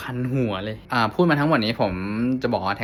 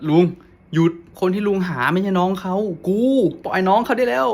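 higher, loud, slowing on the end; retold urgent telling-off; 8 semitones higher, 4 dB louder than usual. Passenger telling the driver to let the stranger go, urgent raised voice. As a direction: firmly order him to let go.

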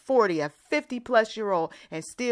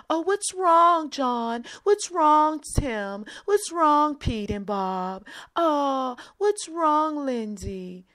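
high, sing-song fussing over a sulker; hammed-up cooing sympathy; mock coddling; 9 semitones higher, 4 dB louder, slower than usual. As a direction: coo at a pouting adult. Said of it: "I'm just making up names".

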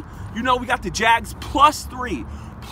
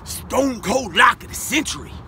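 announcer hype; 2 semitones lower than usual. Acted. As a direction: boom it out like a wrestling announcer. Announcing the big pick.

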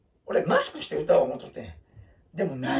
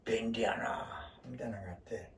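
murmured aside while looking things up; whispered aside; 6 semitones lower, 9 dB quieter than usual. Real, not acted. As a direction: breathe it out quietly to yourself. Whispered aside over pictures.

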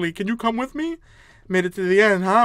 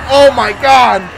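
loud, raised cry of alarm; startled shout; 5 semitones higher, 13 dB louder, slower than usual. Real, not acted. Player startled mid-question, startled shout. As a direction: exclaim it at full volume.

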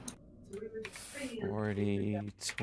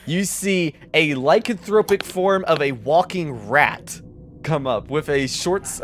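normal talking voice

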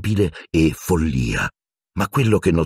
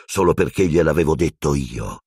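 usual speaking voice

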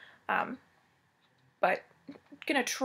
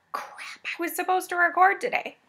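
breathy first word, then a higher-pitched protest; mock sudden realization; 8 semitones higher than usual. Vlogger retelling how Wednesday got away, mock dismay. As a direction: act out remembering a missed task too late.

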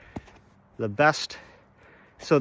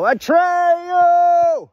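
long, high full-voiced movie yell; 7 dB louder, much slower than usual. Film boy calling out to his friend, yelling. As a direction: holler it out, drawing out the last sound. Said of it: "he would yell".

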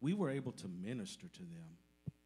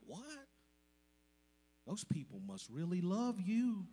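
astonished relatives; 9 semitones higher, 4 dB louder than usual. Retold or imitated. And they were like.

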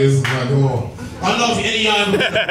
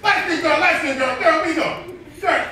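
put-on high, repeated hollers at a girl; pitched-up mock street come-on; insistent catcaller; 11 semitones higher than usual. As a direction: pester her in short high calls. Catcaller calls after a young girl.

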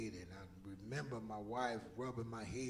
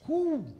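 whoo; 14 dB louder than usual. Real, not acted. Peach juice spilling.

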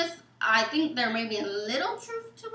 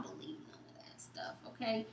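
half-whispered dismissive aside; 16 dB quieter than usual. Real, not acted. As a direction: drop to a whisper to wave it off. Dismissing afterlife stories.

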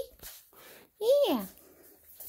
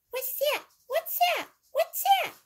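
much higher squeaky question rising each time; squeaky toy question; 12 semitones higher than usual. Acted. Toy asks repeatedly.

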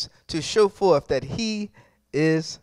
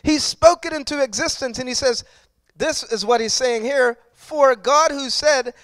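loud, driving sermon voice, hitting each phrase hard; preaching at full force; 5 semitones higher, 6 dB louder than usual. Acted. Pastor exhorting the congregation on God's power, loud full-force preaching. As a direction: preach it out with rising fire.

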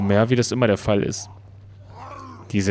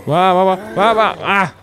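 high, loud stammering panic sounds; flustered panic yelps; 6 semitones higher, 5 dB louder than usual. Real, not acted. Gamer startled mid-fight, loud panicked stammer. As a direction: sputter in a fright.

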